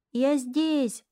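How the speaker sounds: put-on high happy call of a boy; joyful call. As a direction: cheerfully answer the roll call. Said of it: "радостно отозвался".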